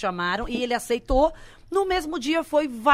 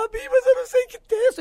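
voz bem mais aguda e desesperada, de leve; aflita imitada; 12 semitones higher, 6 dB louder than usual. Retold or imitated. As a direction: implora ajuda ao amigo em pânico. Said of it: "Eu falei". (pânico imitado)

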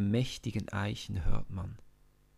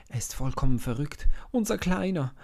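fast, rushed and higher in disbelief; aghast brother stammering; 5 semitones higher, 7 dB louder, faster than usual. Acted. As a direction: blurt it out in shock. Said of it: "stammelt Eliab".

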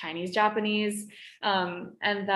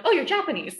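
high, bright mock voice, a bit louder; mocking someone's assumption; 8 semitones higher, 4 dB louder, faster than usual. Strangers guessing she's Japanese, high mocking voice. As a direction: lightly mimic a clueless stranger guessing her ethnicity. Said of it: "and they're like".

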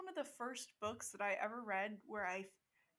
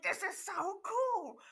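excited; 9 semitones higher, 4 dB louder, slower than usual. Retold or imitated. Retells own childhood excitement.

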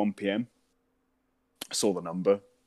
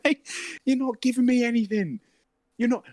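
much higher and louder, fed up; exasperated retort; 13 semitones higher, 4 dB louder than usual. Retold or imitated. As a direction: snap back in disbelief at the pushy salesman.

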